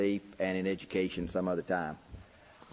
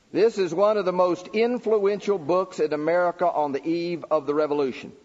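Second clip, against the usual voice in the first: preaching the questions; 7 semitones higher, 5 dB louder than usual. Acted. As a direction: declaim every question like a sermon point. Reads a quote in raised voice.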